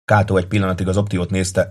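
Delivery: ordinary delivery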